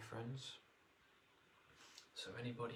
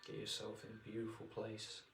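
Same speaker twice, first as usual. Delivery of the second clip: guided meditation. Acted. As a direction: ease them into relaxation.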